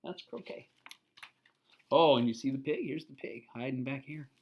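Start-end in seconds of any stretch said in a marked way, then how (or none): none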